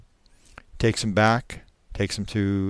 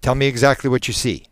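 brisk, raised, pressing demand; very forceful; 4 semitones higher, 6 dB louder, faster than usual. Acted. Hypnotist breaks in on moaning man, forcefully. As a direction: break in firmly and quickly. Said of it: "he breaks in very forcefully".

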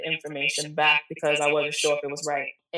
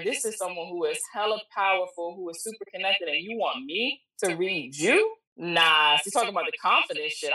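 ordinary talk, nothing marked

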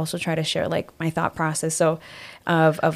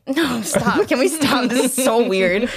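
higher, giggly plea to stop; laughing protest; 5 semitones higher, slower than usual. Retold or imitated. Retelling her refusal at the friend's mirror, laughing protest. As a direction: protest through embarrassed laughter. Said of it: "'cause I'm laughing".

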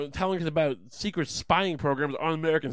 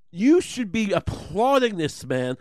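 higher, louder, slow insistence; emphatic rant; 7 semitones higher, 6 dB louder, slower than usual. Real, not acted. Comic defending Assange, loud and slow. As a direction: hammer each word home.

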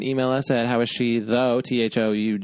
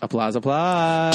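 mock applause call; 5 semitones higher, slower than usual. Mock announcement.

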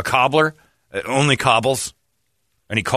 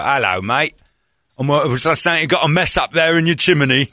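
Cockney chimney sweep; 3 semitones higher than usual. Pretend. Host imagining a Cockney chimney sweep at his door. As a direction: ham up a Cockney sweep greeting. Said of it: "Cockney accent".

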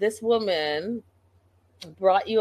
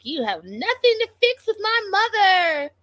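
high, loud mocking squeal on mother; mocking Pedro voice; 13 semitones higher, 4 dB louder than usual. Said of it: "And he's like".

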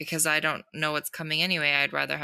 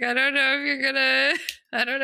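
unsure self-quote; 9 semitones higher, 4 dB louder than usual. Retold or imitated. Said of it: "I was like".

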